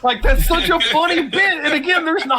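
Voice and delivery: laughing. Real, not acted. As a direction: still cracking up over the scene.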